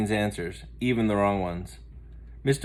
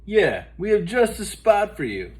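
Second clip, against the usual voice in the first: friendly; 9 semitones higher, 3 dB louder than usual. Acted. Francine welcomes Muffy.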